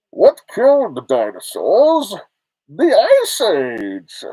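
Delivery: loud, very slow Austrian villain; hammy Austrian action-star bellow; supervillain impression. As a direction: roar it out with huge swooping pitch.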